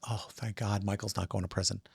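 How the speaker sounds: soft, relieved thought, almost under the breath; relieved inner thought. Retold or imitated. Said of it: "And I was just".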